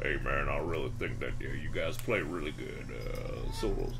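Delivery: deep Southern voice. Retold or imitated. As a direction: drop into a deep Southern accent. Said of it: "he has the deepest Southern voice".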